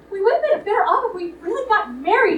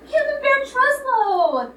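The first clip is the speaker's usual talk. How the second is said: incredulous; 8 semitones higher, slower than usual. Acted. Incredulous at the silly name.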